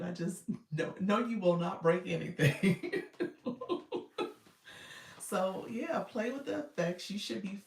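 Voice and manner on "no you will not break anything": laughing. Real, not acted.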